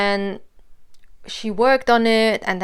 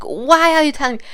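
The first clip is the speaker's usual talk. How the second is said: quick, high, louder protest; retold dismay; 7 semitones higher, 7 dB louder, faster than usual. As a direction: act out baffled dismay, pitched up and hurried. Retold dismay at unwanted confession.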